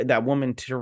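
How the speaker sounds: ordinary delivery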